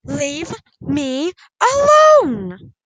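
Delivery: very loud, slow, drawn-out scream; furious yell. A narrator's reading. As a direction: stretch each word into an angry shout. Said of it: "sadness overtaken by a growing wave of anger".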